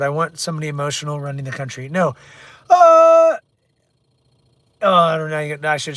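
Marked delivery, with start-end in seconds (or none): 2.7-3.4 loud, high drawn-out groan; exasperated groan. Real, not acted. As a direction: let out a big exasperated "uhh". Exasperated noise after political question.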